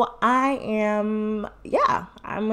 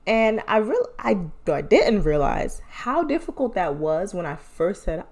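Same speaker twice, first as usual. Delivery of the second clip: normal talking voice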